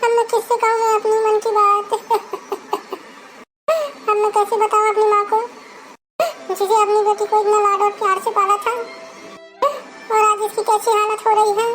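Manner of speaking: wailing, tearful longing for her mother; crying lament. Acted. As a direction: sob it out, calling on God for her dead mother. Bride weeps for dead mother.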